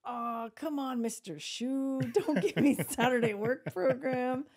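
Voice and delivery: high, drawn-out retold plea; mock pleading. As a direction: build to a loud, hammed-up protest. Retold pupil pleading with his teacher.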